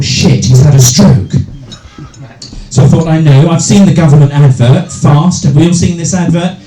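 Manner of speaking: normal talking voice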